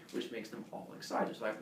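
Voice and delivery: plain reading voice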